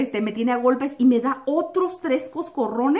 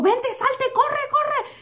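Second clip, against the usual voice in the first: much higher, hurried urging; urgent sister; 13 semitones higher, much slower than usual. Retold or imitated. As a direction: hurry her out like a frantic sister. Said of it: "me dijo". (hermana apurada, mucho más aguda)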